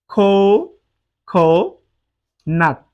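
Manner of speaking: খো, খো, নাট each alone with a long pause; syllable by syllable. Shown as a demonstration. Teacher counting syllables in the word, খো, খো, নাট said separately. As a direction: give each syllable its own beat: খো, খো, নাট. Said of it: "খো একটি syllable".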